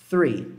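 "three" with tongue between the teeth; three com TH. Shown as a demonstration. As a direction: blow the TH of "three". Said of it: "tem esse som do T H". ("three" soprando o TH)

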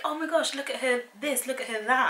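gushing parent. Retold or imitated. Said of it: "I'd be like".

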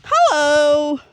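high, very loud drawn-out hello; long, ringing shout of greeting; calling out. Real, not acted. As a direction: holler hello across a field. Calling out across the farm.

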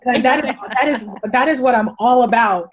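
loud laughing boast; laughing and loud, playfully proud. Real, not acted. Laughing joke about words.

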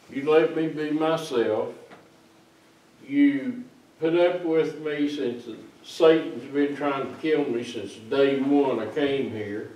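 normal talking voice

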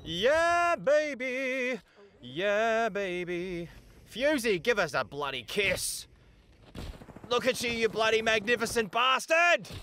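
high, whooping celebration; elated cheering. Real, not acted. Player hailing his magnificent Fusey, elated whooping.

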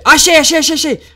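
high, loud burst of discovery; high excited shout of discovery; excited find. Real, not acted. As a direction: blurt it out, thrilled at finding it. Finds the Medusa temple.

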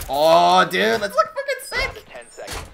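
slow squeaky high excitement; excited. Real, not acted. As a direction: stretch it out, thrilled. Hyped about the mirror spot.

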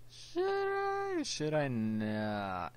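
squealed very high, then dragged out slow; silly dithering. Pretend. Gamer dithers over a move, silly high-to-long squeal. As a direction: falsetto question sinking into a long drawl.

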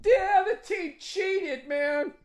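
very high, slow whiny voice; sore loser fan. Retold or imitated. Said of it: "they're like".

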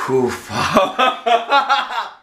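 breathless laugh and whew; nervous laugh. Real, not acted. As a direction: laugh through the burn. Burning mouth, nervous laugh.

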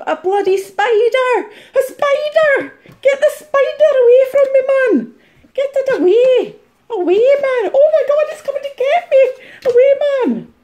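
high, loud spider panic; panicked screaming. Acted. Reseller begs someone to remove the spider, screaming in panic. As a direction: shriek in hammed-up terror.

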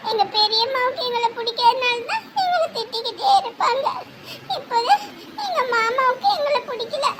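crying. Acted. Child explaining that parents and uncle reject them, sobbing. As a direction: sob the words out in broken phrases. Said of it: "ஐயோ, அழக்கூடாது தங்கம்".

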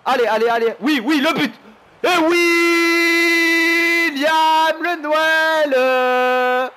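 urgent cheering building to a long holler; real ecstatic gamer shout; overjoyed. Real, not acted. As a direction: belt out the goal celebration, stretching each word. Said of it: "je suis trop heureux".

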